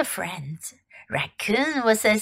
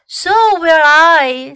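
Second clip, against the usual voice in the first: slow, louder, odd goose voice; honking goose; 6 semitones higher, 8 dB louder, slower than usual. Acted. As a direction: honk the words out slowly.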